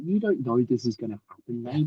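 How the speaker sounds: quietly doubt the coach's plan; muttered doubt. Retold or imitated. Skeptical private thought.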